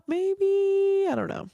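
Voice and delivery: sarcastic maybe. Acted. Sarcastic aside after her advice.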